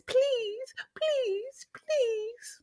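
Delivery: high, soft, breathy whine; whispered whiny begging. Retold or imitated. Imitating her niece's pleading, high and whispered. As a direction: beg in a tiny whimpering whisper. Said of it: "this is whiny voice".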